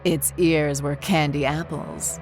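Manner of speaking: ordinary delivery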